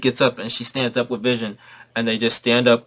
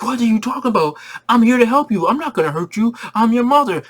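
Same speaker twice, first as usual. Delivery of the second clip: higher, pleading mother voice; pleading mom; 10 semitones higher, 4 dB louder than usual. Retold or imitated. Scarlet Witch begging the scared kids, higher pleading. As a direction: coax them like a desperate mother.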